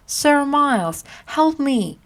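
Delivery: high, pleading call for help; crying out for help. Acted. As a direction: cry out to him across the crowd. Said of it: "he cried".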